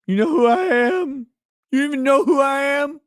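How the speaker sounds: high, loud indignant yell; mock outraged shout. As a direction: bellow it like an offended celebrity.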